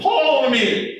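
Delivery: high mock Southern accent. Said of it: "he actually had a Southern accent".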